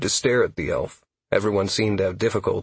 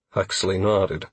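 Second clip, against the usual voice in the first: plain reading voice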